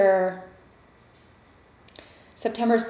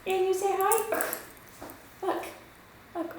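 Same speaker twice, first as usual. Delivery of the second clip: talking to a dog; 12 semitones higher, 7 dB quieter than usual. Real, not acted. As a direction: coax a puppy to greet. Coaxing the puppy.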